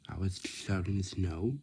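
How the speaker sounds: ordinary talk, nothing marked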